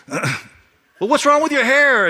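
high and loud mocking jeer; taunting kid. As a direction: sneer it out like a schoolyard bully. Kid taunting new boy.

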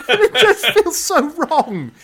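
laughing high and slow; laughing through the words. Real, not acted. Laughing at the plot hole.

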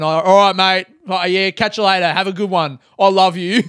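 loud, hearty imitation of a phone goodbye; imitating a bloke cheerfully hanging up; homeless guy signing off. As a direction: sign off to a mate with affection. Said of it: "the idea of a homeless guy".

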